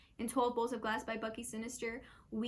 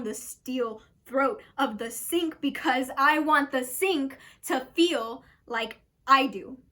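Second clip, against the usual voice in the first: dramatic poem performance. Acted. Performer recites a poem about a shattered bulb, dramatic and building.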